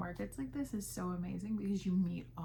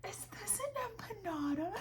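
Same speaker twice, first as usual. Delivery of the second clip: high, delighted question; high-pitched surprised shopper; curious customer voice; 13 semitones higher than usual. Retold or imitated. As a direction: ask it like you just found a treasure.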